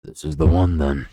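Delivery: loud, gruff grunted words; gruff soldier grunting over the noise of a mob; grunting shout. A narrator's reading. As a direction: bark it out as Heberick shoves. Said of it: "grunting words".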